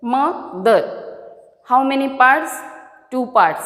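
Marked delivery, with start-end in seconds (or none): 0.0-1.0 "Mother" broken in two, a gap after the first syllable; split into syllables. Shown as a demonstration. Teacher showing learners how the word breaks, "Mother" split in two. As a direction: say "Mother" as two separate beats. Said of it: "I have broken this word".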